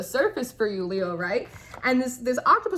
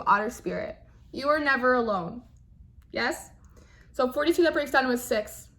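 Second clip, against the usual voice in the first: normal talking voice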